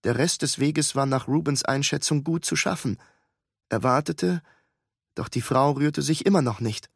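ordinary delivery, nothing marked

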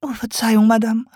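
apologize, flustered, as the owl; embarrassed owl. A narrator's reading.

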